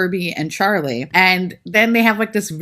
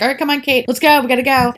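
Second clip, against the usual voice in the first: hurried mock urging; 7 semitones higher, 3 dB louder, faster than usual. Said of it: "Sydney then just, like".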